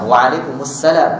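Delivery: Waalaikumsalam said slowly with every syllable clear; slow, careful Waalaikumsalam; correct reply. Shown as a demonstration. Preacher demonstrating the right answer to a greeting, slowly. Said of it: "Yang betulnya".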